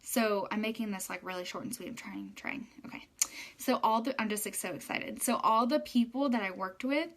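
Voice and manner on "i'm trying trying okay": whispered aside. Real, not acted. Aside about being distracted.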